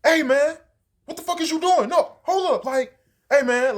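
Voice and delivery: high, loud mock outburst; spazzing out. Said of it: "he starts spazzing. He like".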